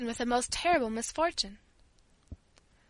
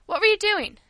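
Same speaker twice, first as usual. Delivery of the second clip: high, loud questioning; raised-voice parental interrogation; stern demand; 9 semitones higher, 7 dB louder than usual. A narrator's reading. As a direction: demand an explanation from a child. Father demands an answer.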